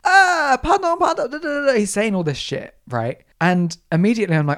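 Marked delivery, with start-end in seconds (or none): 0.0-1.7 high-pitched mock French babble; hammed-up ranting child. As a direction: imitate a pestering kid wailing in French. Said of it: "He comes up to me and he's like".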